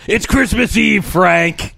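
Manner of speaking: high, loud mock holler; calling out. Ghost outside Frank's childhood home, high hollered reproach.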